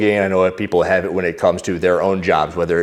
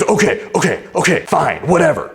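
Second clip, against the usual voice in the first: high-pitched, put-on exasperated woman; exasperated giving in; 7 semitones higher than usual. Woman giving in to airport security, mock exasperated. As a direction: mimic her grudging surrender, pitched up. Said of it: "watching this woman scream at this guy".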